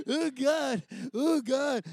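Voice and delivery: moved and embarrassed; a retold half-sob; overcome oh God. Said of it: "You know".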